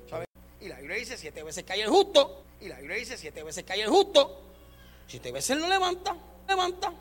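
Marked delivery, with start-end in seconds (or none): 1.2-4.4 louder, slow sermon quote; preaching emphasis. Acted. Preaching at full force. 5.1-6.9 high, slow, ringing; rising preaching cry. Acted. Preaching at full force.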